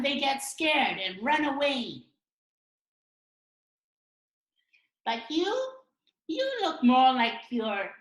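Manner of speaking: high, loud, slow cartoon voice; sceptical comic character. Doubter interrupts Bao Man's big announcement, high loud scepticism. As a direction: lecture the would-be hero in exaggerated storytelling tones.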